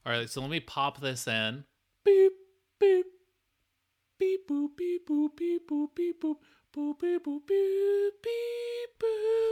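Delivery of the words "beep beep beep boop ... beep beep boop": microwave beeps. Pretend. Microwave beeping noises.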